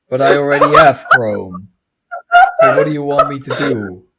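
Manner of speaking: venting at a useless error message; exasperated outburst. Real, not acted. Friend venting about Facebook demanding Chrome, loudly exasperated.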